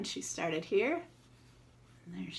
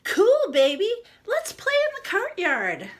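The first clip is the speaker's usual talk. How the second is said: put-on much higher and louder, thrilled kid voice; excited kid; 13 semitones higher, 10 dB louder than usual. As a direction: squeal it like a delighted friend. Friend character replies excitedly.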